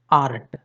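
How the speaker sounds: आर्ट with the र clearly sounded; R pronounced. Retold or imitated. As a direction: imitate people who sound the r in आर्ट. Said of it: "र का यहाँ पर भी उच्चारण कर रहे हैं". (आर्ट में र साफ़ बोलते हुए)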